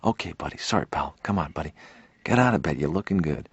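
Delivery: apologetic coaxing. Retold or imitated. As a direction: back off and soothe after the outburst. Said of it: "And you hit the snooze alarm".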